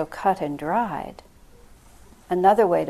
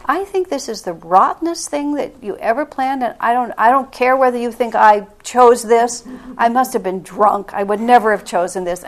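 louder, frank indignant protest; forceful complaint; 3 semitones higher, 8 dB louder than usual. Retold or imitated. Protesting to Divine Mother, forceful and frank. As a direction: let the grievance out straight. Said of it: "say that really out loud to a Divine Mother".

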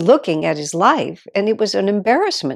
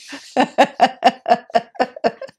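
high warm laugh; 6 semitones higher, 4 dB louder than usual. Real, not acted.

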